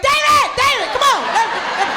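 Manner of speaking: high, loud alarmed shouting; urgent calling. Retold or imitated. Comedian calls little cousin away from danger, acted panic shout. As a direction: yell for the kid to get away now.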